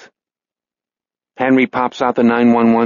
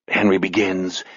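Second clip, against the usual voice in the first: ordinary delivery, nothing marked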